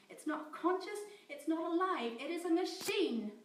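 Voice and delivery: high, loud frantic protest; desperate shouting. Acted. Scientist Amy shouting denial at her captor. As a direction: insist desperately, near tears.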